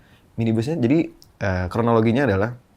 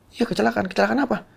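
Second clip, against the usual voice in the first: much higher, urgent quoted phone talk; retold phone call; 12 semitones higher than usual. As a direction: replay the alarmed call in a raised voice. Retold phone call about an accident. (suara jauh lebih tinggi, menirukan telepon yang panik)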